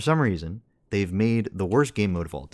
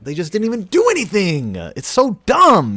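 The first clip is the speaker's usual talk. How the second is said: loud, exasperated outburst; angry rant; 9 semitones higher, 8 dB louder than usual. Real, not acted. Gamer ranting to teammate after a lost match, angry shout. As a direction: blow up at the game in real frustration. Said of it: "All right, rant over".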